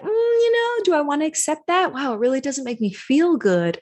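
high, hurt little hmm; taken-aback reaction. Retold or imitated. Hearing mom's remark and feeling small, a high hurt reply. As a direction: sound stung but polite. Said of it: "And you're like".

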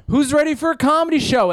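loud, high hype-man call; announcer voice. Retold or imitated. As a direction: pump up a dead room. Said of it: "go to the P A system ... and go".

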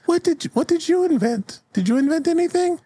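put-on very high child's voice; curious kid piping up; little kid. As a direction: ask it in a tiny squeaky voice. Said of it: "one of the kids raises their hands".

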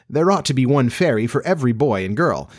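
plain reading voice

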